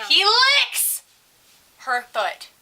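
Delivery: high, loud burst on "licks"; scandalized reveal. Real, not acted. Teller revealing what the kid did, loud and scandalized. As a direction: blurt "licks" high, then drop for the rest. Climax of story, then repeated.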